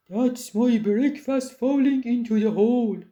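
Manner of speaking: gruff-voiced troll, pleased; a pleased troll finding his hiding place; storybook troll, pleased. A narrator's reading.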